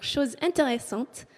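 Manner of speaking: ordinary delivery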